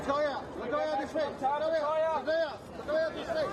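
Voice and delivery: loud, high repeated yells for attention; shouting her name. Real, not acted. Photographers shouting at [name] to look their way, loud yells. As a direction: yell to get her to look your way.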